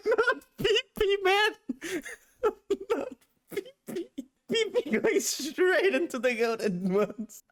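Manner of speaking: high, squeaky laughing through the words; helpless laughing. Real, not acted. Streamer cracking up at an immature Pokemon name, helpless laughing. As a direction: struggle to talk through a giggling fit.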